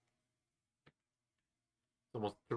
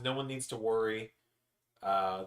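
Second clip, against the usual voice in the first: normal talking voice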